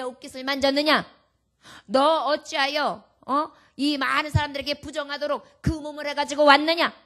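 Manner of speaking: a loud, harsh rebuke in Jesus' voice that the preacher insists he never gave; loud indignant accusation; mock scolding.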